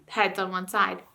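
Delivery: usual speaking voice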